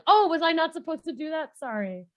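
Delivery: innocent apology. Acted. Map-eater apologising after swallowing, innocently sheepish. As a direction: play clueless innocence, then shrink into the apology. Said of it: "and she's like".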